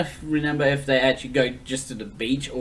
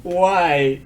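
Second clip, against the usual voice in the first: loud, higher-pitched exasperated complaint; exasperated protest; 5 semitones higher, 5 dB louder than usual. Real, not acted. Co-host objecting to the beautiful tears detail, exasperated protest. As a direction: protest loudly, as if pained.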